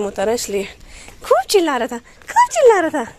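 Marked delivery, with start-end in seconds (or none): 1.2-2.0 high squeaky voice for the dog; a lightly higher cooing pet voice; pet baby talk. Pretend. Voicing the barking dog. 2.0-3.1 put-on much higher, louder squeal of baby talk; cooing to the dog, pitched way up; exaggerated pet baby talk. Voicing the barking dog.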